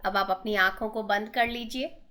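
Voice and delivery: slow, drawn-out meditation instructions with long pauses; unhurried breathing guidance, lots of space between phrases; guided meditation. Acted. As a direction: lead them gently through breathing and relaxation.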